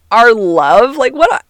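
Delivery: high, loud, incredulous "our love?"; incredulous disbelief. Retold or imitated. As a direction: scoff at it in amused disbelief. Mocking his corny love line.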